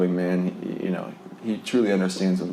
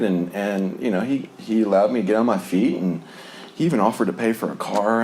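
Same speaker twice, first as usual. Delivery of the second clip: ordinary delivery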